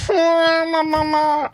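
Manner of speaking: hammed-up frightened maman; mock scared call for mum. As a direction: cry for mummy half joking.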